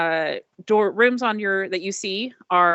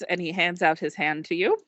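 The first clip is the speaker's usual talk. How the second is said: ordinary talk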